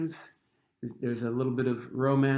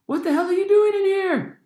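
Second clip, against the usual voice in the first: put-on much higher, loud, indignant girl's voice; annoyed shriek; 9 dB louder than usual. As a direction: shriek at the boy in the girls' bathroom. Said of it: "a girl's voice let out a shriek".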